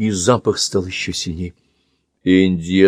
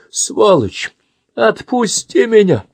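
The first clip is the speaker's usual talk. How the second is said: much higher, louder cry; struggling shout; 13 semitones higher, 4 dB louder, slower than usual. Acted. Pinned Andy screams at the man, struggling shout. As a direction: fight back with a yell. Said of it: "кричит он".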